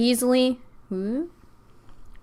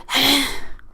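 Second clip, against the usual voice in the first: rough, audible cigarette exhale; imitated smoker's exhale; 4 semitones higher than usual. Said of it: "like, cigarette".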